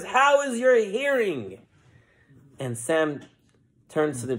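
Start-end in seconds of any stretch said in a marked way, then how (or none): 0.0-1.6 high, slow, stretched-out question; preacher. Acted. Preacher lays hand on Sam, high and grand. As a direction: ask it grandly after the prayer. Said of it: "puts his hand on him and says".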